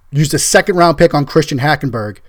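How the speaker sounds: usual speaking voice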